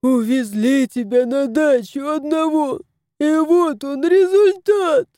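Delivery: mock-tearful wail, rising high; sobbing. A narrator's reading. House spirit begs the dying cat's forgiveness, wailing. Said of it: "рыдал домовой".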